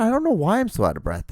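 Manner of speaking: very high, swooping bafflement; mock puzzlement. Host answers an imagined listener, mock puzzlement.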